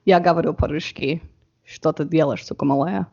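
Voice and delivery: Russian spoken with a confident native lilt; Russian phrase in Russian accent. Retold or imitated. Guest showing off her Russian to the hosts, Russian accent. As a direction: rattle off the Russian like a local. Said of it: "you have a very good Russian accent".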